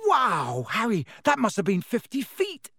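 high, delighted cheer; impressed wow. Acted. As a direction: call out, really impressed. Impressed shout across garden.

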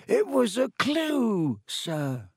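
high, slow elf voice; earnest little elf explaining; house-elf voice. A narrator's reading. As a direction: elf answers Harry's question. Dobby's voice held.

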